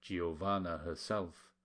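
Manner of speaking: ordinary delivery, nothing marked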